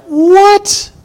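high, loud outburst; scandalized king crying out; shocked disbelief. Acted. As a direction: stretch out the No in disbelief. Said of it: "Abimelech is like".

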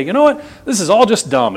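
high, flippant shrug of a voice; mock careless dismissal; dismissive quitter. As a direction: toss the faith aside like it's nothing. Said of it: "one day".

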